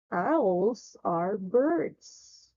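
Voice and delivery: very slow, word-spaced reading; slow model reading. Shown as a demonstration. Teacher reads first before the pupil's turn, slow model reading. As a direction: read it out slowly for a learner to copy. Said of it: "Let me read it first".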